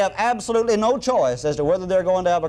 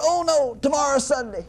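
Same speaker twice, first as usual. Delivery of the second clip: high-pitched imitation of a dismayed boy; retold childish dread, pitched well up; mock dismay; 8 semitones higher than usual. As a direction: suddenly groan over bad news, hammed-up and high. Acts out buddy's sudden dismay.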